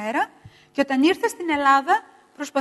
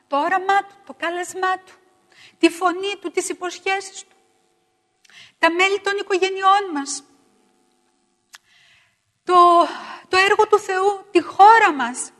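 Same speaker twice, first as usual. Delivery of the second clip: higher, building prayer petitions; fervent prayer; 6 semitones higher, 5 dB louder than usual. Acted. Prayer list, rising.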